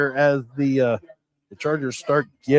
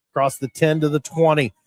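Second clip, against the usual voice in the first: ordinary talk, nothing marked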